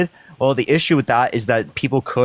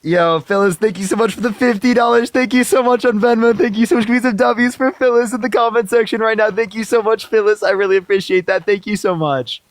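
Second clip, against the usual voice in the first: high, put-on gushing streamer voice, much higher; mock donor shoutout; 9 semitones higher than usual. Streamer mockingly thanking Phyllis for fifty dollars, high put-on voice.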